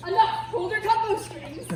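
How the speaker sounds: loud and sharp command; commanding shout. Acted. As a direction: call the fairies off. Puck commanding fairies.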